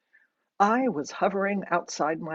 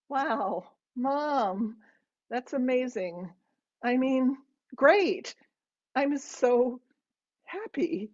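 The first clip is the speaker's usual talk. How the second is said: high, halting attempt at enthusiasm; feigned delight; 6 semitones higher, slower than usual. A narrator's reading. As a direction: force out delight in short, hesitant pieces. Daughter feigning delight to her mother.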